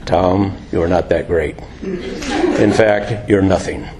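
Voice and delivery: low quiet God putting Tom in place. Pretend. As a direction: answer from above, low and calm. Voice of God answering.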